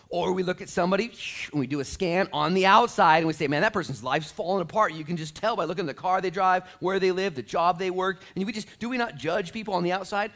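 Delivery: high, loud, driving preacher voice; full-force preaching. Real, not acted. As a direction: pile up the list with rising force.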